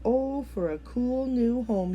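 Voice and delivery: a mild wistful cry from a hot frog; longing cry. A narrator's reading. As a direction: wish aloud, drawn out and longing. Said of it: "he cried".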